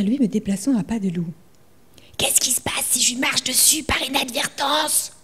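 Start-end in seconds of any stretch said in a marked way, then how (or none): 2.2-5.1 softer, breathy whisper. Acted. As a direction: whisper so the spider won't hear. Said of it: "je chuchote".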